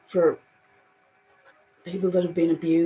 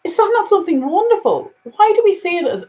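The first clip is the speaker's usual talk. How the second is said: high and loud, exasperated disbelief; 11 semitones higher, 7 dB louder than usual. Real, not acted. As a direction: throw up your hands at the absurdity. Exasperated rhetorical question.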